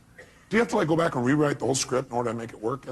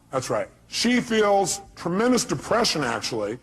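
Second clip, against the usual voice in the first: usual speaking voice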